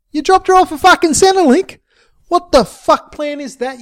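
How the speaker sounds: very high, scornful disbelief; incredulous challenge. A narrator's reading. Friend questions Jimbo's plan for Sheila, incredulous challenge.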